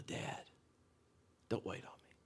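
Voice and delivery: near-whisper, fragile and low; hushed bedside plea. Retold or imitated. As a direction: murmur it gently to a dying parent. Bedside farewell to dying father.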